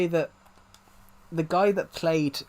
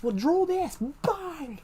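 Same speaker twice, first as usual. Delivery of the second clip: far higher voice leaping up on a film line; mock attack cry; slower than usual. Actor re-enacting the punch line from his short film, mock high-pitched cry. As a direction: jump way up high into a drawn-out squeal.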